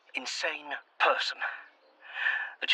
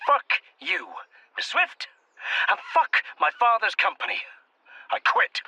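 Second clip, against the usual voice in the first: angry outburst; 8 semitones higher than usual. Acted. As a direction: throw it in her face. Quitting in anger at Swift.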